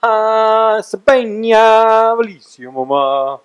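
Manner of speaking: high, stretched-out name calling; Vine voice. Retold or imitated. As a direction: call the names like a Vine skit. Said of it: "I did the".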